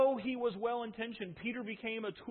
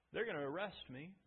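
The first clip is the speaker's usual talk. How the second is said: softer, letting it sink; quiet foretelling; 4 semitones lower, 7 dB quieter than usual. Acted. Jesus quietly telling disciples of his arrest. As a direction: say it low and heavy.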